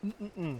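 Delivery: a long real yawn hummed through closed lips; bocejo. Real, not acted. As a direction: yawn it out, mouth shut. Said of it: "I don't know why I'm yawning so much".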